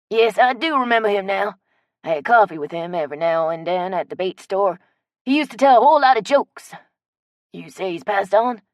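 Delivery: a friendly country geezer remembering a friend; old Cajun man. Acted. Old man Chet voiced.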